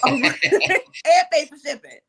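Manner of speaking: laughing through the words, slow. Real, not acted. Host repeating her shipping joke to viewers while laughing. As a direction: giggle out the punchline.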